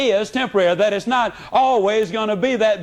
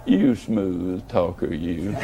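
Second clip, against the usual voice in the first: much lower, slow, smiling tease; lower, slower, smiling "You smooth talker, you"; teasing smile; 13 semitones lower, 4 dB quieter, slower than usual. Retold or imitated. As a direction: purr it low and slow with a grin. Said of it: "you kinda smile and say".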